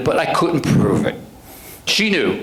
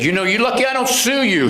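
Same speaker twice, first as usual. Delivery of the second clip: higher, pushy lawyer voice; indignant attorney; 9 semitones higher than usual. Retold or imitated. Defense attorney threatening the sergeant, higher and indignant. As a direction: scold him like a smug defense lawyer.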